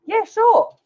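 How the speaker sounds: high, loud eager yes; cheery agreement. Real, not acted. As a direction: answer the chat eagerly. Replying brightly to chat.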